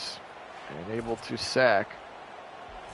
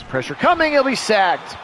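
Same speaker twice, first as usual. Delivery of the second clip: excited sack call; 10 semitones higher, 6 dB louder than usual. Acted. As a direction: pitch leaps up, louder, calling the sack live. Live sack call, rising pressure.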